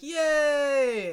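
put-on high, loud child's cheer; cheering kids. Kids celebrating the slain dragon, high cheer. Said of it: "the other kids are like".

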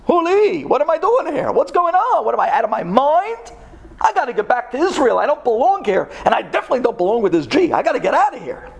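high-pitched, alarmed self-questioning; acted panic. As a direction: act out a man suddenly desperate to get out. Acted inner panic, wants out.

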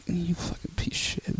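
hushed, whispery cursing; muttered disgust. Retold or imitated. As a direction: mutter the insult under your breath. His disgusted thought about the driver.

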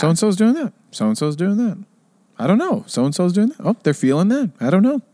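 lightly voiced live-and-let-live shrug; unbothered observing. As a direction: toss off each remark without judgment. Imitates housemates shrugging things off.

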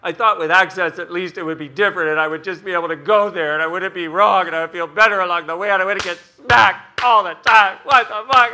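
builds from a put-upon whine to a loud high rant; whiny humanoid freakout. Retold or imitated. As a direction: spiral from complaining into outraged yelling. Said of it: "the humanoid in you goes".

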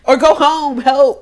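high, loud call; loud mock plea. Real, not acted. Friend yelling at a character on screen to leave. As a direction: shout it at the screen.

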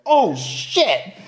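high-pitched startled realization; mock shocked. Gang kid spots sheriff behind him, mock shock. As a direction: imitate a kid caught out by the law. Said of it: "he sees this sheriff".

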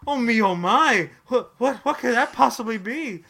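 overacted amazement selling a product; infomercial host. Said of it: "you just infomercialed these poor people".

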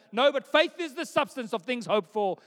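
loud recital, hammed up; mock insistence. Mocked believer answering doubters with scripture, loud insistence. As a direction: protest loudly as a mock zealous believer.

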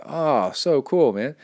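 usual speaking voice

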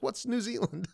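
put-on high, puzzled kid voice; mock kid asking why; nine-year-old self. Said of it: "Be like".

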